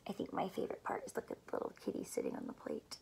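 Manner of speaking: fast and hushed, almost a whisper; hushed aside. Real, not acted. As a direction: share it quietly, fast. Quiet fast aside about picture.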